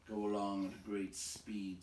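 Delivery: ordinary delivery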